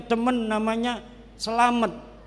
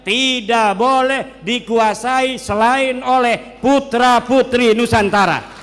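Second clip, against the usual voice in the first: slow, loud and higher, hammering each word; rousing declaration; 3 semitones higher, 7 dB louder, slower than usual. Real, not acted. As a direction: proclaim it from the pulpit. Sermon climax on the homeland. (seruan lantang dan lambat seperti khotbah)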